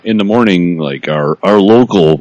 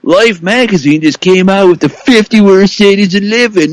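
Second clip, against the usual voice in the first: mock DJ voice; 13 semitones higher than usual.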